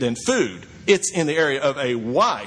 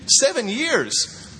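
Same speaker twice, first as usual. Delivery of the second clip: incredulous; 8 semitones higher, much slower than usual. Acted. Preacher mocks Jacob's deal.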